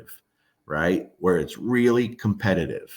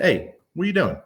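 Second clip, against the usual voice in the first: mock stern call to dog; 9 semitones higher, faster than usual. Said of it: "I literally say".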